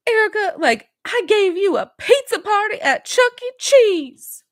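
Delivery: hammed-up whiny imitation of a castmate bragging; mocking Sutton. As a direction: parody a self-pitying rich woman.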